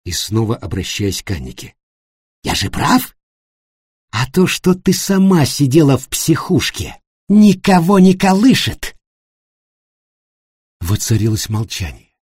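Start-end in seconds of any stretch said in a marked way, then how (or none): 2.4-9.0 spiteful. A narrator's reading. Spiteful jab in quarrel.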